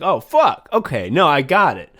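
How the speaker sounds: exclaimed retold aha; mock realization. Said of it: "And I'm like".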